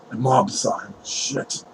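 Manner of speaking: very soft, low dismayed muttering; dismayed aside. Acted. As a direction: grumble privately to yourself. Lord mutters about the cover-up.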